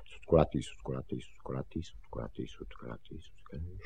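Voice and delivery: very soft, low muttering to oneself; hushed, barely voiced repeating; muttered repetition. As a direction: memorise it under your breath. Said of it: "si je desetkrát opakoval".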